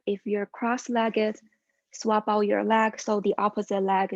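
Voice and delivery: calm, steady yoga cueing voice; yoga instruction. Acted. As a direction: guide the class through each pose. Leads a yoga class.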